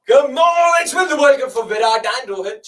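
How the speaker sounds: loud, high rallying shout; excited cheer. Real, not acted. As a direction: fire up the team at full volume. Cheering on the team.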